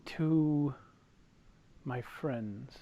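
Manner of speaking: soft, halting near-whisper; hushed solemn reply. Acted. Game master as Jack answering what the carving is dedicated to, hushed. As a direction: let it sink away quietly.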